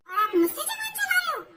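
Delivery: high, loud outraged cry; angry shout. Acted. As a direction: fling it back at him in fury. Bhabhi's heated quarrel with devar. (गुस्से में चीखकर)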